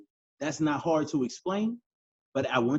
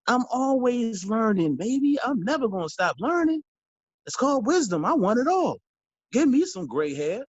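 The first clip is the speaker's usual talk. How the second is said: excited; 6 semitones higher, 3 dB louder than usual. Real, not acted. Hyped self-declaration.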